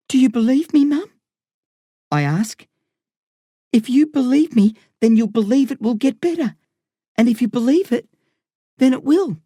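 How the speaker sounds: a son begging his mum to hold on; young boy pleading. A narrator's reading.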